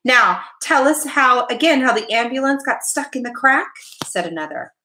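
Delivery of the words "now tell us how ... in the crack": high-pitched youngster asking for more; excited cousin's request; eager request. A narrator's reading. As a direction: plead keenly, pitch lifted. Cousins begging for the story.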